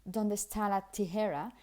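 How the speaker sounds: imitated Anglo accent in Spanish, t's aspirated; Spanish with English-accented, puffed t's; English speaker's accent in Spanish. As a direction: mimic a British or American tourist's Spanish accent. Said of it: "American or a British speaker speaking Spanish".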